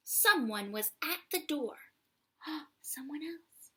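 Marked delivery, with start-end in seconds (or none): none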